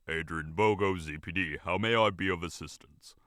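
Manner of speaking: low, gruff practiced phone greeting; gruff buffalo chief. A narrator's reading. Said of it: "came the gruff, practiced response".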